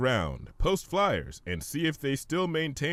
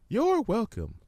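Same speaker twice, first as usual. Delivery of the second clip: much higher, gentle pony voice; Fluttershy; 13 semitones higher, slower than usual. Acted. Fluttershy answering the hummingbird, high and gentle.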